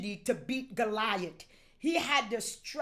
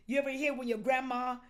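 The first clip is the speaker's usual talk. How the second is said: ordinary talk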